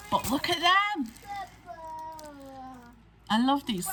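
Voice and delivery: high-pitched cooing delight; excited gushing. Real, not acted. Crafter showing viewers little toppers, excited gushing. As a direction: gush in a high voice, dropping at the end.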